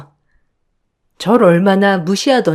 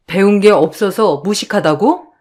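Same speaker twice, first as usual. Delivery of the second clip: affronted echo. Acted. Eldest challenges younger sister-in-law's insult, affronted questioning. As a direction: throw the insult back, rising at the end.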